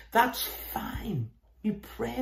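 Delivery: whispered reassurance. Real, not acted. Preacher reassuring a struggling believer, whispered. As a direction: breathe it as a gentle whisper.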